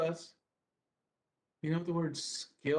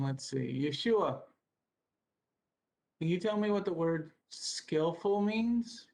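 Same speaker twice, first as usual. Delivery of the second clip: ordinary talk, nothing marked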